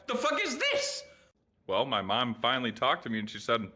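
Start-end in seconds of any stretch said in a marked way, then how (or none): 0.1-1.0 high baffled curse; mock bewilderment at the noise; confused. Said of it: "And I'm just like".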